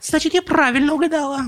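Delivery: very high, slow squeaky exclamation; high-pitched excited bird voice; exclaiming chick. A narrator's reading. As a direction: stutter it out in a tiny chick squeak. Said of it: "воскликнула Тутта".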